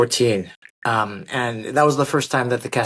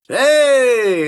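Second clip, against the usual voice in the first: a big, high greeting called across the lot; calling out across to a waving fan; imitating the actor's loud hey; friendly hail; 8 semitones higher, 4 dB louder than usual. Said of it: "and he goes".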